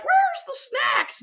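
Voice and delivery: high, drawn-out shouted question; giddy demand. Retold or imitated. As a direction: holler it like a rowdy kid at a party. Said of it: "we're like".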